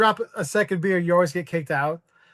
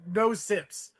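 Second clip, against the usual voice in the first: usual speaking voice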